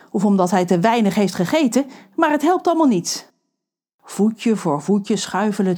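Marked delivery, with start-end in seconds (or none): none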